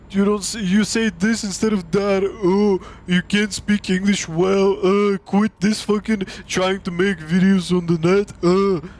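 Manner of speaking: hammed-up scornful nitpicking; mocking shamer. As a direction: mimic someone ridiculing another's English. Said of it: "they were shaming me".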